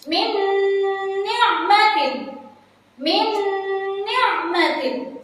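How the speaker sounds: slow recitation, lingering on the nasal join of "Min ni'matin"; nasal merging (idgham). Shown as a demonstration. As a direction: chant "Min ni'matin" with a long hummed n. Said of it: "harus dimasukkan dengan cara".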